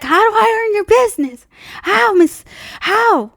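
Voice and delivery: high, eager, pleading salesman voice; mock the salesman begging for the sale; pushy salesman. Mimicking the salesman begging for her business, high and pushy. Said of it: "The guy was like".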